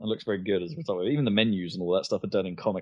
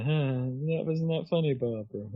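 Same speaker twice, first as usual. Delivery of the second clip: mock deadpan character; 6 semitones higher than usual. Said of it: "And it's like".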